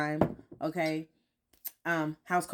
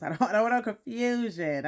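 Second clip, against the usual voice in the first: laughing; 4 semitones higher, 3 dB louder than usual. Real, not acted. Joking aside about husband.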